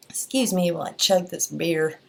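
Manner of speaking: playful fake Southern twang; mock Southern accent. Vlogger joking about beer, mock Southern accent. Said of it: "we don't have Southern accents here".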